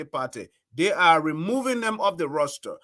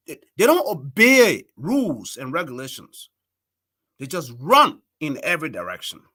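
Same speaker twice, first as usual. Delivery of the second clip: ordinary delivery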